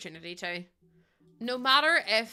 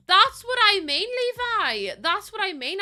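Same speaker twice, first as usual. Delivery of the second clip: high, loud excited repeat; excited agreement; 11 semitones higher, 10 dB louder, slower than usual. Real, not acted. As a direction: burst out agreeing with the chat. Excitedly agreeing with chat reader.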